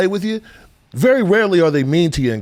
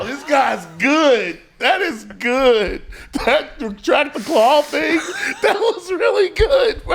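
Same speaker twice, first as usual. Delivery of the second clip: much higher, slow, breaking into laughter; can't believe how good that was; excited laughing praise; 10 semitones higher, slower than usual. Real, not acted. Amazed by the retract claw line.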